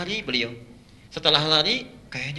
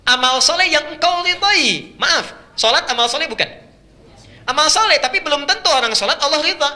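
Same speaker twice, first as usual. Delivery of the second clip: much higher and louder, emphatic; forceful preaching; 11 semitones higher, 7 dB louder than usual. Acted. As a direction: hammer the point to the congregation.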